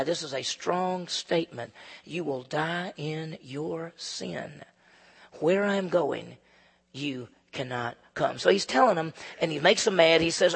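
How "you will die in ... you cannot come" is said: very slow, much softer warning; grave and hushed. Acted. Pastor quoting Jesus' warning to the leaders, slow and grave. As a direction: let each word fall like a sentence.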